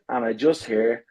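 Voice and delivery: ordinary talk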